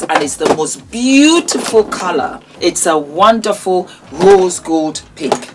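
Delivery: normal talking voice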